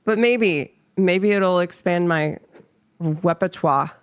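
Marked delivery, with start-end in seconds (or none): 3.0-3.9 "repertoire" fumbled into a slurred near-miss; mispronounced word. Real, not acted. Streamer reaching for a fancy word, fumbled "repertoire". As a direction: stumble over "repertoire", blurring the first syllables. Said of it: "can you try that word again?".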